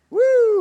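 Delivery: high, loud "woo"; short, high-pitched cheer; playful whoop. Pretend. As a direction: whoop it up in mock celebration. Mock boast about being well-off.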